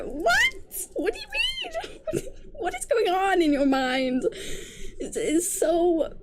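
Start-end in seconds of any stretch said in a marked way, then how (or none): 0.0-4.2 very high, laughing "what?"; squealing disbelief. Real, not acted. Reviewer shrieking with laughter at the hero. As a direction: shriek in amused outrage.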